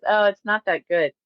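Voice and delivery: usual speaking voice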